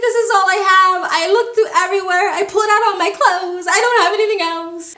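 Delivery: high, loud, fast pleading; fake desperation. Traveler faking empty pockets to the official, overacted pleading. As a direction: insist you have nothing left, overacted. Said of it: "So I put on a whole performance".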